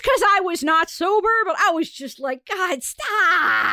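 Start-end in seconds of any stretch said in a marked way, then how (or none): 2.5-3.7 exaggerated cringe, higher and drawn out; pained mock plea; cringing plea. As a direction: beg it to stop, stretching the last word.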